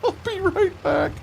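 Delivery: high, squeaky laughing voice; cracking up. Real, not acted. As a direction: gasp it out through laughter. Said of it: "that's funny".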